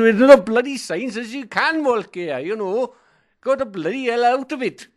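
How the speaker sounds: high, loud furious yell at a trespasser; angry farmer shouting. Retold or imitated. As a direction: bark like an outraged farmer. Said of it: "He said".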